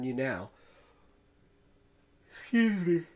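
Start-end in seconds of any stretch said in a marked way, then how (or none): 2.4-3.0 yawning. Real, not acted. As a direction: excuse me said mid-yawn, pitch pushed high. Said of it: "Pardon me for the yawn".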